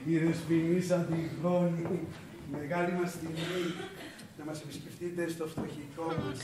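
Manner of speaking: slow, drawn-out courteous greeting; gallant welcome. Acted. Suitor receiving Miss Antigone at his humble place, gallant. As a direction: stretch the words out in ceremonious politeness.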